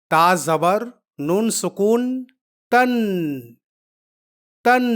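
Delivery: teacher drilling the sound تن; spelling-out demo. Shown as a demonstration. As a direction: spell it out, then repeat تن with a held n. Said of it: "اب دونوں کو پڑھتے ہیں".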